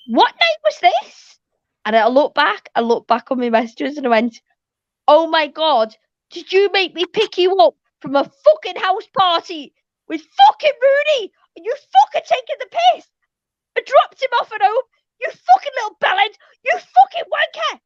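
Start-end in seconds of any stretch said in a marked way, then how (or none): 0.1-1.4 high, slow dawning question; the penny drops, stretched and high; high slow disbelief at her husband; shocked realization. Retold or imitated. Realizing husband was with Rooney. 5.1-17.8 screeching fury at the husband; furious rant. Retold or imitated. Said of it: "I was fuming".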